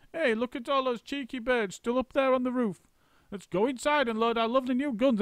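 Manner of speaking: put-on high, sneering old woman; a nasty old wife's cackling voice; shrill Missus Twit. A narrator's reading. Missus Twit sneering to husband about the monkeys.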